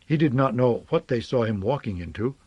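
plain reading voice

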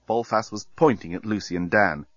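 ordinary delivery, nothing marked

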